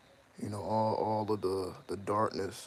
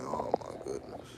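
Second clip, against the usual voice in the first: low, slow dismayed sigh; mock dismay; 7 semitones lower than usual. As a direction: drag it out low, a little less deep. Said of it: "I'm like".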